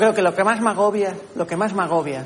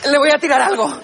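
mock panic; 6 semitones higher than usual. Acted panic fleeing bear.

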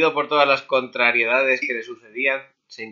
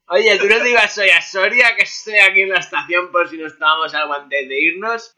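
loud friend on phone; 6 semitones higher, 5 dB louder than usual. Acted. Voicing Hugo on phone.

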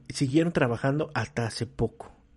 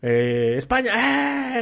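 mock fan shout; 9 semitones higher, 4 dB louder, slower than usual. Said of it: "Y ya".

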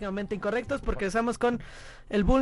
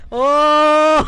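loud gasp; 6 semitones higher, 14 dB louder than usual. Real, not acted. Joke reaction, loud outburst.

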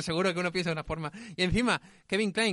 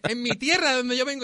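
retold quote; 7 semitones higher, 3 dB louder than usual.

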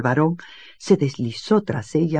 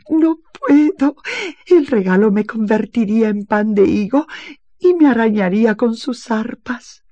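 sobbing; 8 semitones higher, 6 dB louder than usual. Acted. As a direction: refuse through tears. (negativa llorosa y aguda)

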